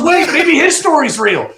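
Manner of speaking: high, loud hammed-up second thought; retold sudden realization. Acted sudden realization in story.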